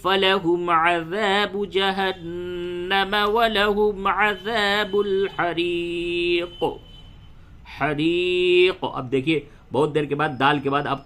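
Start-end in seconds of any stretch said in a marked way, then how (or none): 0.0-6.6 slow, high, drawn-out melodic chant; Quran recitation. Shown as a demonstration. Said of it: "تو یوں ہوگا". 7.8-8.8 حریق with the final ق bounced in a slow, drawn-out chant; qalqalah on qaf. Shown as a demonstration. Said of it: "یہاں پہ بھی قلقلہ کیجئے".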